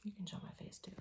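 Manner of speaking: whispered aside to the camera person; hushed aside. Real, not acted. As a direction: whisper it off to the side. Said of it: "unvoiced whisper aside".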